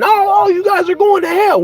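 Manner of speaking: high, loud mock alarm; mock condemnation. Believer supposedly condemning people out there, hammed-up high shout. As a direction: cry it out like an over-the-top doomsayer.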